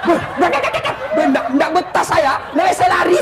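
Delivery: high, panicky stammering of a fleeing jinn; panicked jinn. Acted. The old jinn escaping the nagging wife, high and panicky stammer. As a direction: stammer and bolt like a terrified spirit.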